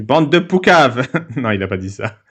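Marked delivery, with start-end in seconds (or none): 0.0-1.1 loud, drawn-out joke insult; mock outburst. Host inventing the messenger's reply as a joke, mock outburst. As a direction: call it out in pretend indignation.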